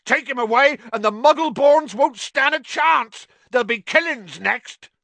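loud, high, furious warning; yelling. A narrator's reading. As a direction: yell the warning at full force. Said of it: "yelled Hagrid".

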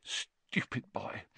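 very soft, voiceless, slow and choked; choked near-whisper; thick, tearful. A narrator's reading. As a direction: force it out slowly through acted tears. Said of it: "blew her nose and said thickly".

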